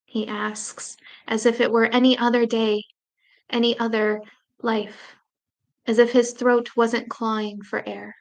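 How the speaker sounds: ordinary delivery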